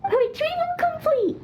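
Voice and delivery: high, chirpy report; playing a proud little soldier; proud announcement. Child announces success.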